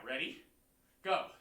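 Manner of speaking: ordinary delivery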